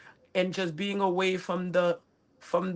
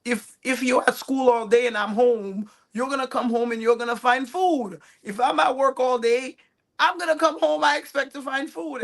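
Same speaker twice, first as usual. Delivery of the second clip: firm dad lecture; 5 semitones higher, 5 dB louder than usual. Retold or imitated. Dad laying down rules.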